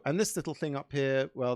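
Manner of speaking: ordinary talk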